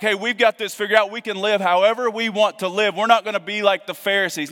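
lightly mock the self-satisfied church; a hint of a cocky know-it-all; mock arrogance. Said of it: "that's arrogant".

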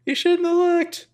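high, slow put-on voice; mock warning voice. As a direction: draw it out high like a teasing warning. Host quotes line in put-on voice.

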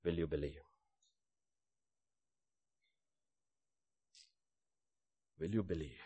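low, soft, searching question; hushed appeal. Real, not acted. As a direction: ask it quietly and let it hang. Preacher puts a solemn question.

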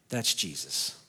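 ordinary talk, nothing marked

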